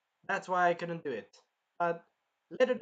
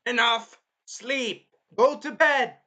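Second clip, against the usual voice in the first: high, loud shouted orders; fed-up shouting, imitating a scolding hero; angry command; 8 semitones higher, 10 dB louder, much slower than usual. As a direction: snap out each order at full volume. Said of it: "that's what I would be acting".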